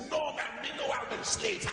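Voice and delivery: high mock Jamaican denial; Jamaican accent. Singer wanting to lie to the Jamaican brother, Jamaican accent. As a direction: deny it in a put-on Jamaican accent. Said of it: "use a Jamaican accent back".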